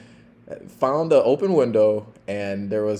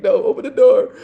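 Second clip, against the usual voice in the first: high, breathy laugh-through command; laughing order; 10 dB louder than usual. Retold or imitated. Officer to drunk on the floor, wheezing with laughter. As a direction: gasp it out between laughs.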